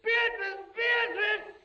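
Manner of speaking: screaming her name, high and loud; anguished screams. Acted. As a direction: scream after her in despair. Screaming as Beatrice vanishes.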